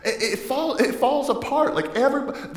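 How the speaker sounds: high, loud burst of comic exasperation; laughing exasperation. Real, not acted. Preacher telling the congregation about family meeting chaos, high-pitched exasperated outburst. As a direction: cry it out high, half laughing.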